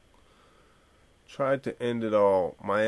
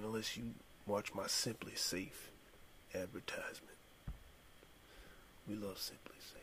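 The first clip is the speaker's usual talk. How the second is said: mock ad whisper; 18 dB quieter than usual. Fake ad tagged onto quote.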